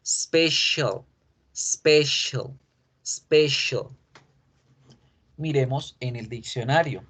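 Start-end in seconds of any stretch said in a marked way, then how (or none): 0.1-3.9 "special" with sh, spe-shul, no starting e; English special. Shown as a demonstration. Teacher repeats the word for students, clear English. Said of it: "tiene la sílaba si".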